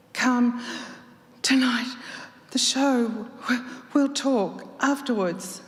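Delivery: breathless, high, halting words; gasping invite to the lover; wheezing. A narrator's reading. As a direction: push each word out short of air. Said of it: "Starr wheezed".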